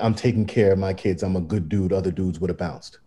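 mocking mumble. Host mocking a celebrity dad's line to Rachel, low sneering mumble. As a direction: mutter it low like a smug excuse.

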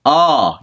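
loud open ah. Shown as a demonstration. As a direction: open up and sing ah. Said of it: "you need to open".

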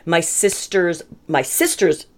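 ordinary delivery

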